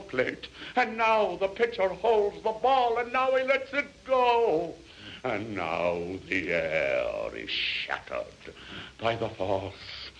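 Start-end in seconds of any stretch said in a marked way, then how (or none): 0.7-4.7 high-pitched, urgent climax; mounting excitement. A narrator's reading. Narrator at the moment the pitcher throws, high excited build.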